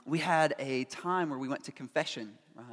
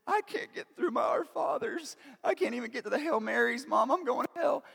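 high, mock-tearful child's wail; imitated crying child; 8 semitones higher than usual. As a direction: whine it high like an upset little kid. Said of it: "I'm crying".

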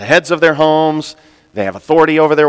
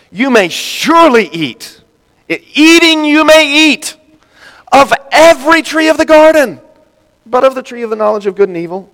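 enthusiastic; 11 semitones higher, 4 dB louder than usual. Acted. Preacher voicing God's permission, high and excited. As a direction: proclaim the generous gift with delight.

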